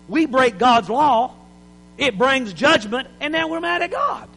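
normal talking voice

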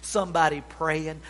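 ordinary talk, nothing marked

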